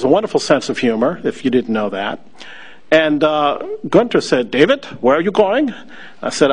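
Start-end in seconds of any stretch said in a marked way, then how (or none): 4.5-5.7 put-on German accent. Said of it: "rather thick German accent".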